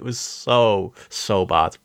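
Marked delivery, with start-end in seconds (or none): none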